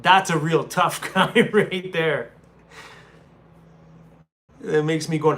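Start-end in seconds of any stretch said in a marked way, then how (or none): none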